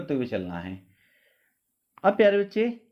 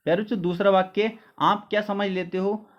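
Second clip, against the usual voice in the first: normal talking voice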